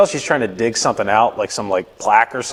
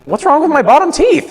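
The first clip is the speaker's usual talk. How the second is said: very high, louder offended squeak; mock indignation; 5 dB louder than usual. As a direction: protest in a put-on high voice. Acted indignant patient reply to dentist.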